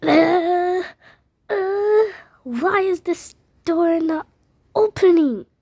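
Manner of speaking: high, rising panic; panicked. Acted. Girl trapped in the elevator, panicking at the door. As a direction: struggle with the stuck door.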